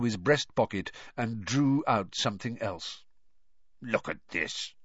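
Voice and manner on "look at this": low, soft menacing growl. A narrator's reading. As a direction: growl it low under breath. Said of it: "he growled".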